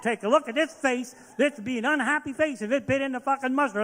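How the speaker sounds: loud, high furious rant at the counter; angry rant. Retold or imitated. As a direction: rage at them, yelling it out. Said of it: "I said".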